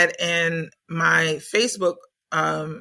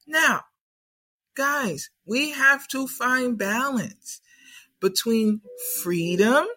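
higher, coaxing grandmotherly voice; wise ancestors; 4 semitones higher than usual. Retold or imitated. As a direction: soothe them round the kitchen table. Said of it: "the wise ancestors is like".

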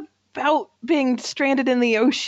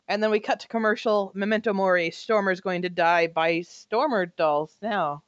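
ordinary talk, nothing marked

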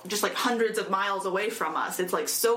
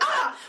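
mock alarmed yelp; 3 dB louder than usual. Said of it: "United Nations like".

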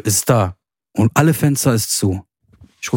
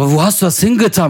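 upset question; 6 semitones higher, faster than usual. Retold or imitated. Son on the phone demands where his mother put it, upset. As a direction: press his mother for an answer. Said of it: "Ich sag".

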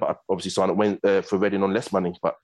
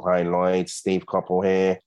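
slow, drawn-out caller introducing himself; Steve Coppell; much slower than usual. Retold or imitated. Manager phoning the player out of the blue, slow impression. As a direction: stretch the phone greeting out slowly. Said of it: "is that how he talks as well?".

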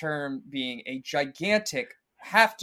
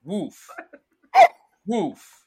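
dog noises spoken as words, pitched up; clueless fake bark; 13 dB louder, much slower than usual. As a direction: bark badly, like someone who has no idea how. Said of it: "doesn't know how to actually bark".

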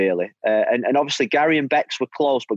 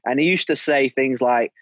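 ordinary talk

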